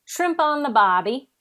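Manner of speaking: loud mock Aussie catchphrase; Australian accent. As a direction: throw out a hammed-up Australian line. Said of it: "There you go. You're back in it".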